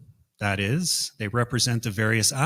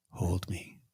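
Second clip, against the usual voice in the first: despairing whisper; 3 semitones lower, 10 dB quieter than usual. Acted. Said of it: "as she called back".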